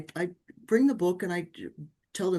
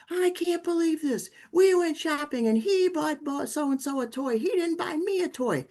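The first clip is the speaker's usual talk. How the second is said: put-on upset child; 10 semitones higher, 3 dB louder than usual. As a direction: pitch it way up like a hurt ten-year-old complaining. Angry ten-year-old son voiced.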